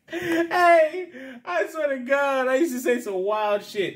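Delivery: high-pitched talk through real laughter; laughing. Real, not acted. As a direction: crack up while confessing it. Laughing at his own story.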